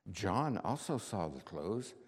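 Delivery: ordinary delivery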